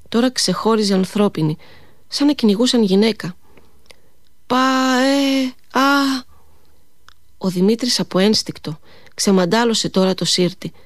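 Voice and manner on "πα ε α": high far-off wail for help; a thin cry carried on the wind from far away; desperate distant cry. A narrator's reading. A woman chased in the snow calls out in despair, high and far off. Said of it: "απεγνωσμένη κραυγή".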